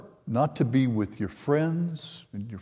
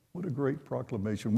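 softer echo; 9 dB quieter than usual. Shown as a demonstration. Repeats praise of the proclamation.

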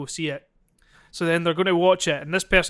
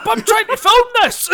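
much higher, louder outraged yell; shrieking complaint; 8 dB louder than usual. Acted. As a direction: yell over the chaos. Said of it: "quite a good wee skit".

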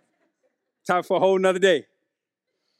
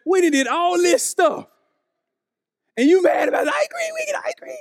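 exasperated parent; 9 semitones higher than usual. Retold or imitated. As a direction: climb higher and higher in mock disbelief until it cracks into a yell. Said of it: "And you're like".

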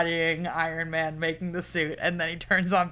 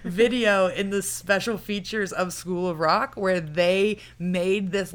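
normal talking voice

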